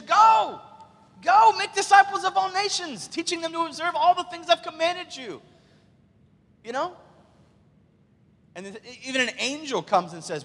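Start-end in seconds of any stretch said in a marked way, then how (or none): 0.0-5.4 high, loud rallying command; preacher's proclaiming cry, held high; commanding shout. Acted. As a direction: send them out with a ringing order. Acted commissioning command to disciples.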